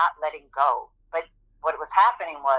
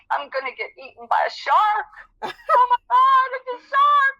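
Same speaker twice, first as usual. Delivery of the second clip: high-pitched panic rising into a scream; retold panic; 5 dB louder than usual. Swimmer seeing a shape underwater, screaming in retold terror. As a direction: build from alarm to a loud, high shriek. Acting out her panic at a shark.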